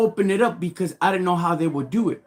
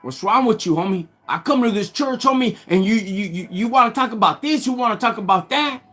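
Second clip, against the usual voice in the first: worked-up, higher-pitched rant; chewing them out; 4 semitones higher than usual. Retold or imitated. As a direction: re-enact an indignant telling-off, pressing each accusation. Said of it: "I start chewing them out".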